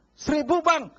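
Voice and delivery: loud, cheeky lowball offer to the tired vegetable seller; mock lowball shout. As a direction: hammer the vendor down to a tiny price. Lowball offer in haggling scene.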